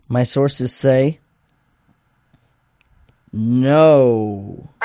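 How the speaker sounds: slow setup, long pause, big drawn-out NO; suspenseful reveal. Acted. Reading the Magic Eight Ball's verdict, suspenseful reveal. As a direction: hold the answer back, then boom a long "no".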